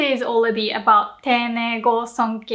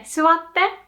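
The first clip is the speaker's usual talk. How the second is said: casual; 5 semitones higher than usual. Shown as a demonstration. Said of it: "when talking to your friends".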